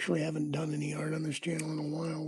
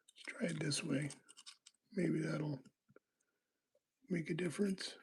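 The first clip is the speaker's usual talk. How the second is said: soft whisper to himself; 7 dB quieter than usual. Real, not acted. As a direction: whisper it, focused on the lock. Muttering to himself while picking.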